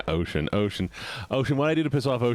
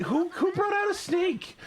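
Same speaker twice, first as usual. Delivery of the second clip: startled laughing shout. Real, not acted. Startled by a snake.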